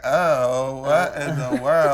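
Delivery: slow, put-on dismayed voice; disapproving women. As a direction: mimic the appalled womenfolk, drawing it out. Acting out disapproving girls in family.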